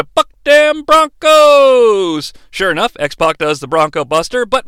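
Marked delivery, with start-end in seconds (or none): 0.0-2.3 high, slow, stretched-out holler; hyped catchphrase call. Pretend. Announcing the Bronco Buster, drawn-out high holler. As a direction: holler it out, dragging the last word.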